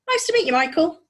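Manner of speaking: put-on high, loud girl calling; girl's voice. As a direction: call through the wall cheerfully. Girl calls through the wall.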